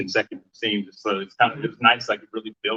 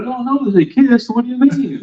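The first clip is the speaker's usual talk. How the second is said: high, loud, drawn-out protest; playful squeal of indignation; mock outrage; 7 dB louder than usual. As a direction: blurt it out in hammed-up disbelief. Playfully acts out scandalized outburst.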